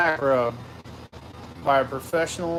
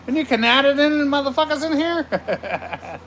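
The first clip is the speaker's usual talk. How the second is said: calling out; 10 semitones higher, 3 dB louder than usual. Real, not acted. Calling out to viewers.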